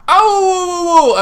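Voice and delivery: loud, high dog howl; high wailing dog impression; imitated husky howling. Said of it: "This dog just looked at me and just started going".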